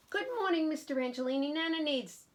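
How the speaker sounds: put-on child's greeting.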